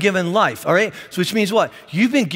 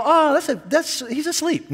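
higher, slowing mock-innocent guess; mock naive voice; 8 semitones higher than usual. Driver seeing a cat in the street, higher mock-naive voice. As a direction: play it dim and sweet, stretching asleep.

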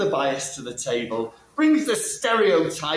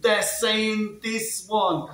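slow realization; 5 semitones higher, much slower than usual. Real, not acted. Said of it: "click, eureka moment".

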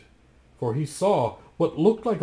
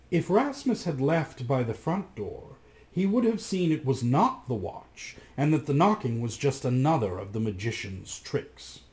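usual speaking voice

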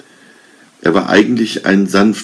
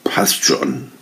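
softer, husky whispered words; hoarse whisper; 5 dB quieter than usual. A narrator's reading. Arne cowed by the king, hoarse whisper. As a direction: give in with a barely voiced whisper. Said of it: "flüsterte heiser".

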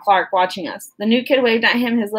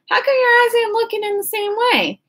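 put-on timid country boy; 11 semitones higher than usual. New kid shyly asking Kenny about his eye, high voice. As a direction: ask it gently, a little embarrassed.

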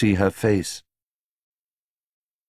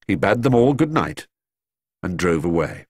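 ordinary delivery

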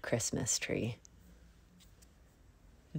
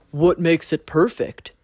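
louder deer voice; 2 semitones higher, 7 dB louder than usual. A narrator's reading. Deer asks Bunny what makes it perfect, louder. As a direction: ask it curious as the deer. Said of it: "asked Deer".

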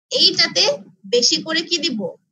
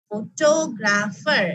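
Photographer very slow and lower, টো stretched; stress on second syllable; 4 semitones lower, much slower than usual. Shown as a demonstration. Teacher shows hand test, stressed টো in slow, low photographer. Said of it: "second সিলেবলে আমি stress দিচ্ছি ফটোগ্রাফারে".

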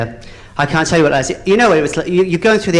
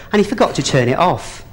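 ordinary talk

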